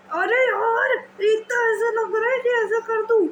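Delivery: a hammed-up tired whimper; tired kid groaning. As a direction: groan like a worn-out student back from school. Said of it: "जैसे आप school से आए".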